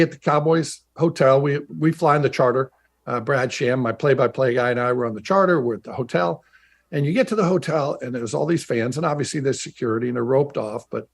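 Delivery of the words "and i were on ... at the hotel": ordinary talk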